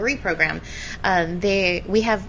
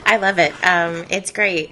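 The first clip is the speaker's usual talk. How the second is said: laughing; 11 dB louder than usual. Real, not acted. Guest laughs after applause joke.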